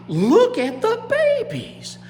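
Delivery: high, loud, drawn-out exclamation; astonished gator parents. Acted. Gator parents spotting their babies' skin, high loud astonishment. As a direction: stretch it high in amazement. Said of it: "daddies' eyes got big".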